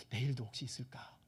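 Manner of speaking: low, hushed question to oneself; whispered wondering. Acted. Israelites wondering about the next morning's manna, in a whisper. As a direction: whisper it as a private doubt.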